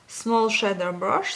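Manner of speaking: slow, French-accented English; careful English in a French accent; terrible English accent. Real, not acted. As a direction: read the English label slowly with French sounds. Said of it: "mon accent anglais terrible".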